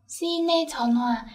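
very slow, careful teacher demo; slow model reading. Shown as a demonstration. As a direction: say each syllable slowly for the class.

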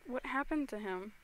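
usual speaking voice

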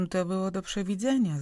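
ordinary delivery, nothing marked

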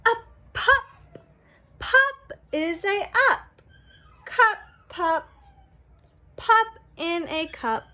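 bright, sing-song picture-book reading; reading to children. A narrator's reading. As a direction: read it out like a storybook for little kids. Reads book text aloud.

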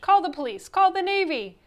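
loud, high, mounting alarm; alarmed shriek. A narrator's reading. Coach calls for help against the creature, shrieking. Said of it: "shrieked the coach".

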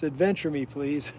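usual speaking voice

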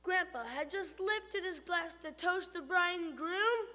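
ordinary delivery, nothing marked

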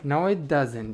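ordinary talk, nothing marked

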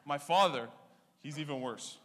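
ordinary delivery

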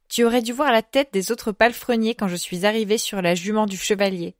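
ordinary delivery

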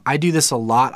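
ordinary talk

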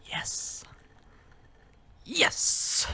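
whispered, gleeful "yes"; whispered cheer. Pretend. Player privately cheering news of the breakup, gleeful whisper. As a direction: celebrate under the breath, breathy and unvoiced.

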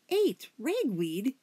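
slow, high, horrified gasp; half gargle, half squeak in shock. A narrator's reading. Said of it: "finally gasped, her voice half gargle, half squeak".

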